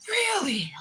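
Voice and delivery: very high gasped mock amazement; astonished gushing. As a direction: imitate an awestruck admirer, high and over the top. Smitten man amazed at no extensions.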